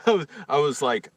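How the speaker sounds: ordinary delivery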